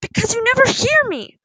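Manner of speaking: loud, high-pitched outburst; shouted protest. A narrator's reading. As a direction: shout back at a mother. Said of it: "Poppy shouted".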